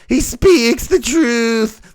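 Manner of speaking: slow, spaced mock statement; girl's stiff quote. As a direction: drag out each word solemnly. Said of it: "She's all".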